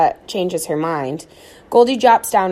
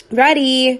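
unenthusiastic; 6 semitones higher, 5 dB louder than usual. A narrator's reading. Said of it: "doesn't sound like it".

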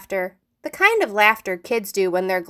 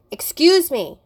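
sharp raised call across the room; teacher catches a student passing notes, raised call; stern teacher calling out; 6 semitones higher, 4 dB louder than usual. Acted. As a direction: stop the kid mid-act.

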